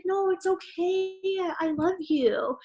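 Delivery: soft, slow comforting voice; a hint of a gentle boyfriend soothing; lightly voiced consoling partner. Partner comforting her when she complains, soft and slow. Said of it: "he'd be like".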